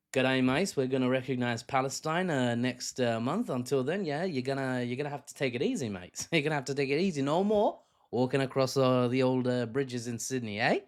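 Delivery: hammed-up Aussie accent, badly faked; jokey imitation of an Australian Prime Minister's accent. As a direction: attempt a matey Australian accent, not very well. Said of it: "Not, not the best accent".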